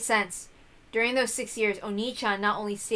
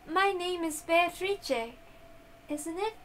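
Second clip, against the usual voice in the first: higher and slow, unsure little voice; uncertain young girl; 8 semitones higher, slower than usual. Acted. Young Beato asks her servant about her name, slow and unsure. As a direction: ask it hesitantly, as if unsure.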